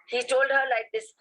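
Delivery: ordinary talk, nothing marked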